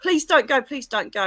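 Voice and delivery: high imitation of begging, easing off on the repeat; acted-out desperate begging, starting loud; retold hysterical pleading. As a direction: re-enact a distraught plea. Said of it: "I'm like a hysterical wreck".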